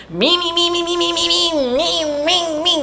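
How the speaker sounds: high buzzing chainsaw noise; imitated chainsaw. Leatherface swinging his chainsaw at the truck, buzzing imitation. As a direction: mimic a revving chainsaw. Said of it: "Leatherface is just like".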